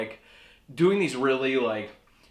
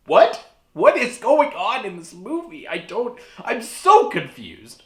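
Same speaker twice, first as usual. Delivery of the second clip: exasperated; 7 semitones higher, 7 dB louder than usual. Retold or imitated. Reacting to movie, exasperated.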